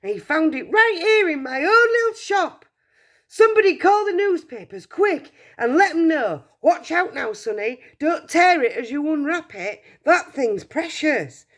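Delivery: excited shout. Acted. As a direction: yell to the whole shop, beside yourself. Shopkeeper still shouting excitedly.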